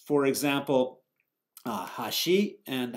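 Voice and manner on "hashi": "hashi" as a Japanese pitch-accent word, first of a contrast. Shown as a demonstration. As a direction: say "hashi" slowly with one pitch pattern. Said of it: "by the changing the pitch".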